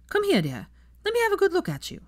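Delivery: an eager grandmother beckoning; Andromeda. Acted.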